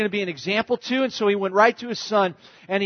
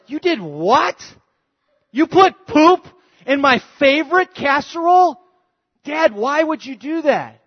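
higher, louder appalled disbelief; appalled son; 9 semitones higher, 5 dB louder than usual. Retold or imitated. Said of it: "the son is like".

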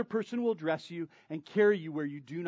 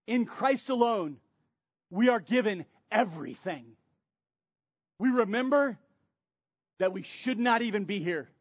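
preaching at full force; 4 semitones higher, 7 dB louder than usual. Acted. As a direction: build it like a pulpit crescendo. Preaching builds to climax.

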